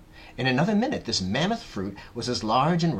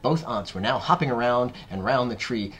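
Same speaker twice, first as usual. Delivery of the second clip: plain reading voice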